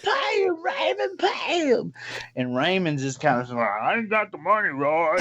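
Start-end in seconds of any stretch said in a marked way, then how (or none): 0.0-1.9 very high mock pleading; screechy, insistent put-on woman voice. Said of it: "she starts to escalate the situation". 3.8-5.2 high-pitched whiny excuse, imitated; mock a defensive country guy; put-on voice pitched up in protest. Voicing fat Raymond's protest.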